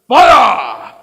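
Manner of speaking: explosive high military order; shouted command. Acted. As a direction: bellow it as a firing order. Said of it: "The order is given".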